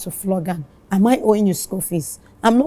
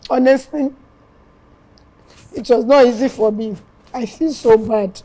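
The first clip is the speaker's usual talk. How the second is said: tearful; 3 semitones higher, 4 dB louder than usual. Real, not acted. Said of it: "I do not mean to make you cry".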